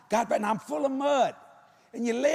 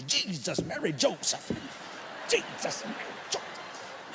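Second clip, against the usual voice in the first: muttered prayer; 6 semitones lower, 8 dB quieter than usual. Retold or imitated. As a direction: pray under your breath.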